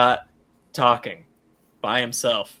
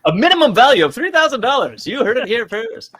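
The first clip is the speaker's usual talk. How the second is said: high, loud hyped announcer; 9 semitones higher, 6 dB louder than usual. Pretend. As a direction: sell it like a cheesy TV ad. Mock ad announcer.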